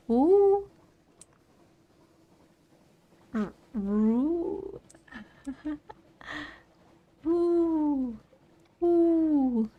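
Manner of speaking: high hooting ooh; owl hoot. Pretend. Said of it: "owl noise".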